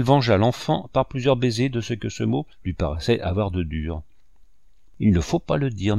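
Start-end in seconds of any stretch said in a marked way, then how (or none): none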